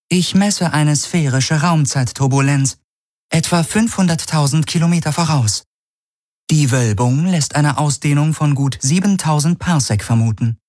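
hohe, sachliche Maschinenstimme; Bordcomputer. Acted. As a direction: wie eine nüchterne Schiffs-KI melden. Das Schiff analysiert. (das Schiff meldet der Crew seine Messwerte, hoch und sachlich)

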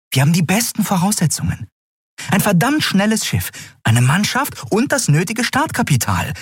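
high, convinced character voice; high-pitched, confident persuasion; earnest Endriel. Acted.

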